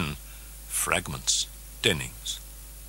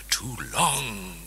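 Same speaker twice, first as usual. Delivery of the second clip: soft anxious whisper; worried whisper; 3 semitones higher, 3 dB quieter than usual. A narrator's reading. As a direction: breathe it out in worry. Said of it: "worried Karras".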